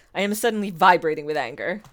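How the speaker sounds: ordinary delivery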